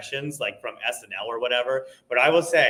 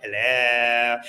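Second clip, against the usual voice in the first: comic impression; 3 semitones lower, 3 dB louder than usual. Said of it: "Nathan Lane and Billy Crystal".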